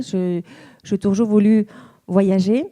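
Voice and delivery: normal talking voice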